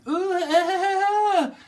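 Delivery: long high-pitched loud wail; high, drawn-out silly squeal; mock squeal. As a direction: let out a sustained piercing "ooh-aah". Breaks into mock cry before teasing.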